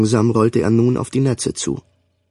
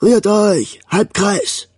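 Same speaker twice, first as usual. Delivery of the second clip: high, clipped command shout; drill command; 9 semitones higher, much slower than usual. A narrator's reading. The commodore ordering his men into a semicircle, a drill shout. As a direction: snap the order at the ranks.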